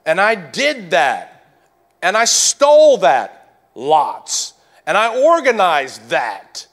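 loud, rising preacher's cry; full-force preaching. Acted. Pastor to congregation, full-force preaching. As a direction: preach it hard, pleading with the church.

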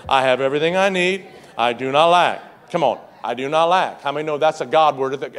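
loud leading the church in unison; rally them to say it with him; loud call and response. Acted. Pastor leading the church, loud unison recitation. Congregation reciting loudly together.

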